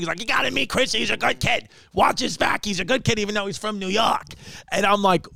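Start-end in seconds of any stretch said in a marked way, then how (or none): 0.2-4.3 high, excitable glad-handing voice; animated, pitched-up mock introduction; Lenny Clark. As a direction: vouch for the kid like a Boston big shot. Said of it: "Lenny's doing the same thing".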